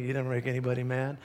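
normal talking voice

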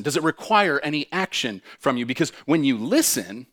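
normal talking voice

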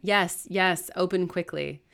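loud, urgent reply; calling out. Acted. Brother calling through the door, urgent. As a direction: raise your voice to be let in. Man outside calls to be let in.

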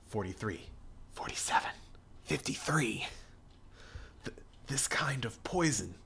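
hushed whisper, calming himself; whispered inner thoughts. Acted. The priest whispers to himself amid the frogs. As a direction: whisper it to yourself to stay calm.

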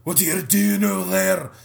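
lightly rolled Scottish R's; Scottish accent. Retold or imitated. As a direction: roll the R's like a Scotsman. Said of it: "'cause he's Scottish, so he rolls those R's".

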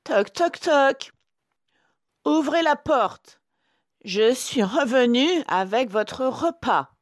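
high, loud falsetto disguise; sweetened wolf voice. A narrator's reading. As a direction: coo like a wolf posing as mother. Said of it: "de sa voix douce de loup". (fausse voix douce de loup, aiguë)

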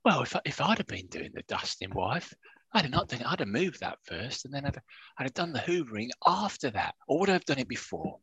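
half-joking impression of a soft-spoken football man; Kevin Gage impression. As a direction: quietly mimic the old manager musing. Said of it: "What I was thinking in my own voice".

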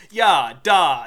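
loud mock Russian accent; bad Russian accent. Podcaster mocking actors who attempt Russian accents, bad Russian accent. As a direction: put on a hammy Russian accent. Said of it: "work in a bad Russian accent".

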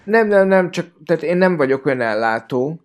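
normal talking voice

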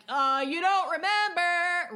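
loud, drawn-out mock accusation; high, loud taunting imitation. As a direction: throw it out high and slow, mockingly.

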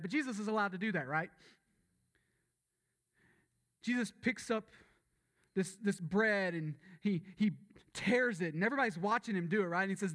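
high-energy sermon build, driving every phrase; preaching at full force. Acted. Pastor firing up the church about Jesus, full-force preaching. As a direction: preach it big to the congregation.

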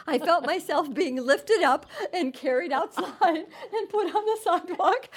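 laughing through the story. Real, not acted. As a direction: laugh while you tell it. Laughing while retelling being carried out.

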